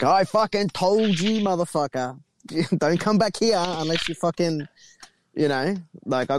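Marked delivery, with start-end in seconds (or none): 0.0-4.7 scolding spirits. Retold or imitated. Spirit beings scolding him, retold.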